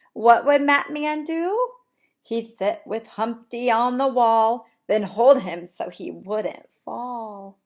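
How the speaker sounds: bright, lively storybook reading; animated read-aloud. Acted. Teacher reading to her class, lively storybook voice. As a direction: read it big for the little ones.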